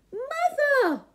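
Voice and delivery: high, loud panicked call; urgent shout. A narrator's reading. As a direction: yell for help in a hurry. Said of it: "he shouted".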